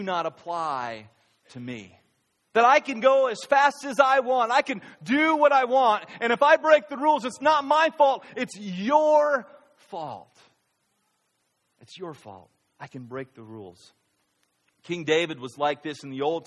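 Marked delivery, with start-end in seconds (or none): 2.5-10.4 high, loud, fast boasting defiance; defiant pride. Retold or imitated. Voicing proud rule-breaker. 11.8-13.9 much softer, low "It's your fault"; quiet pride. Retold or imitated. Soft repeat of the proud voice.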